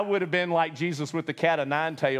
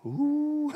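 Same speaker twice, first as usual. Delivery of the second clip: mock ooh; 11 semitones higher than usual. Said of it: "somebody's like".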